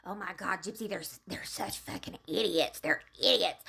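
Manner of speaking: put-on a sneering low aside about his kids; hushed mock teacher. Mocking teacher griping about his students.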